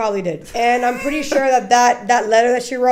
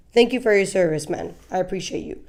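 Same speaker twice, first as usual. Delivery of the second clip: normal talking voice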